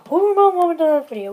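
shouted high and fast; loud. Acted. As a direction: yell the question out fast. Repeats question, second time loud.

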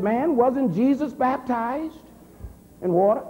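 retold heated challenge, high-pitched; heated comeback with rising pitch; getting hot. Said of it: "I started getting hot".

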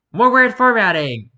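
high, loud sarcastic cheer; a burst of mock glee; mock excitement. Real, not acted. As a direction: cheer the bad formatting like it's a gift. Mocking the article's bad formatting.

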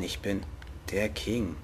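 ordinary talk

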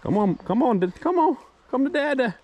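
ordinary talk

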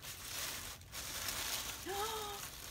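long, soft awed gasp; delighted gasp. Real, not acted. Crafter opening a friend's gift, awed gasp. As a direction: draw in a real, astonished breath.

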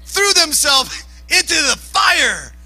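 slow, high-pitched exclamation; incredulous preacher raising the voice; appalled shout. Real, not acted. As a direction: cry it out high, stretching the words. Preacher appalled, imagining own son.

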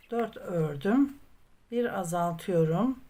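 ordinary delivery

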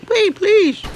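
very high, loud frightened squeal; high-pitched terrified plea; scared pleading. Real, not acted. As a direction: beg in a panic. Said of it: "I'm scared".

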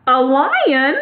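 high, mildly startled mouse queen; crying out. A narrator's reading. Mouse queen hears of the lion, crying out. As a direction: cry it out as an alarmed question. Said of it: "cried the little Queen".

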